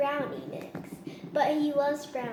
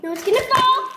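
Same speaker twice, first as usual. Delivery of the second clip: loud, high panicked cry; alarmed shout; 10 semitones higher, 12 dB louder, faster than usual. Real, not acted. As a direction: yell as it tips. Camera tipping over.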